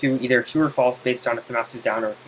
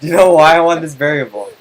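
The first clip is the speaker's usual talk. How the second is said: loud, insistent repeated question; exasperated demand; 3 semitones higher, 10 dB louder than usual. Real, not acted. Tutor demanding an answer from student, loud and exasperated. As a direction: press the question hard, much louder.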